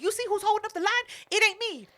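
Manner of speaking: high, quick pointed jab; pointed vindication. Retold or imitated. Customer telling Keela who really holds up the line, pointed. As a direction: needle them in a high voice.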